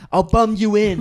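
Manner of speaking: hammed-up quote of the tyrant coach; mock drill sergeant. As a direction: spit it like an angry bandleader. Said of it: "he does say, doesn't he".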